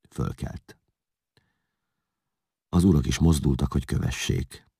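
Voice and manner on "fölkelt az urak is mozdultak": ordinary delivery, nothing marked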